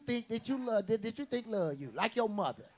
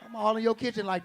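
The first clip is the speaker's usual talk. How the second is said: ordinary talk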